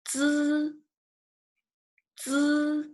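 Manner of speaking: "zi" as a long, high, level buzzed dz; Mandarin zi sound. Shown as a demonstration. Teacher introducing the first new initial to students, Mandarin zi sound. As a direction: hold "zi" high and even, like the end of "needs". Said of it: "the first one is".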